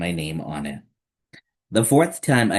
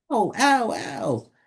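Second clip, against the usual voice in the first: whimpering ow. Acted. Child hurt by bump.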